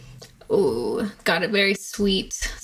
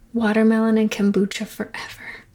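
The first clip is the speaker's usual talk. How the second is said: usual speaking voice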